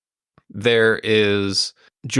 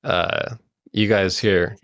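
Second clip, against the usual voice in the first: normal talking voice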